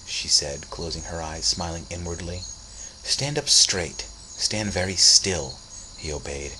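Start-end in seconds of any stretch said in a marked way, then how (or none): 3.0-5.6 slow commands. Acted. Cece giving commands.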